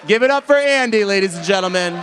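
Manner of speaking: calling for applause. Real, not acted. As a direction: whip up applause with a high holler.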